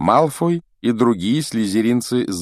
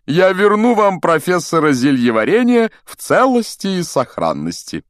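high, pompous showman's voice; grandiose proclaiming; 10 semitones higher, 5 dB louder than usual. A narrator's reading. Vain professor introducing his assistant to pupils, grandly proclaiming. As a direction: lilt grandly high, with patronising assurance. Lockhart goes on with his speech.

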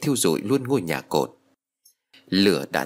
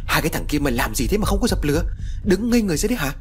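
put-on rising, higher scolding yell at the boys; angry scolding; 8 semitones higher, faster than usual. A narrator's reading. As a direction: bark at the idle boys, building up. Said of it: "Tiếng của bác Khôi quát tháo". (quát mắng giận dữ, càng lúc càng cao)

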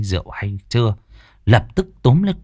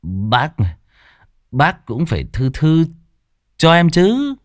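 put-on drunk slurring; 7 semitones higher than usual. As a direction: drag the words like a drunk. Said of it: "Nó tiếp tục cái giọng lè nhè".

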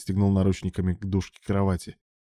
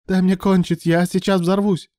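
high, loud urgent plea; desperate exclamation; 11 semitones higher, 5 dB louder than usual. A narrator's reading. Alan implores his lover, loud and desperate. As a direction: cry out, unable to wait. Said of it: "воскликнул он".

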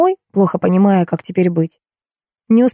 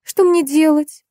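high, slow acted wail; narrated tearful cry of despair; 10 semitones higher, slower than usual. A narrator's reading. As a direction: wail helplessly, stretching the words. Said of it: "в голос зарыдала Жанна".

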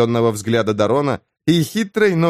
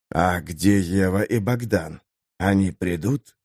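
put-on young vampire voice; 4 semitones lower, 5 dB quieter, slower than usual. Young vampire Damian asks.

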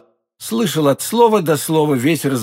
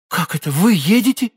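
higher-pitched startled question; surprised exclamation; 6 semitones higher than usual. A narrator's reading. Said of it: "воскликнула госпожа Бонасье". (удивлённое восклицание)

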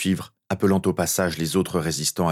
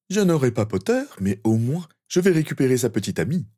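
higher-pitched, haughty boast; 6 semitones higher than usual. Acted. Malfoy claims Potter's girlfriend as consolation, haughty. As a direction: toss it out with arrogance. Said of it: "lança une voix hautaine".

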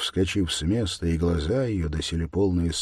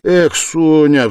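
loud, higher, drawn-out exasperation; irritated cry; 10 semitones higher, 14 dB louder, much slower than usual. A narrator's reading. Man snapping at Sonya's objection, irritated. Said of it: "вскрикнул он раздражительно".

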